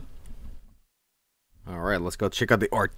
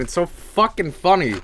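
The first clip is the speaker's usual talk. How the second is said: ordinary delivery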